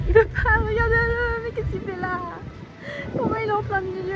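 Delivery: high, loud exasperated complaining. Real, not acted. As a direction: whine in high disbelief. Exasperated at blocking animal.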